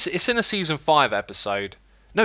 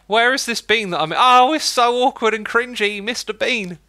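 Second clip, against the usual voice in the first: high, loud mock cringe; hammy silly voice; 9 semitones higher, 5 dB louder than usual. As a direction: overact the awkward cringe. Hammy Mister Bean bit.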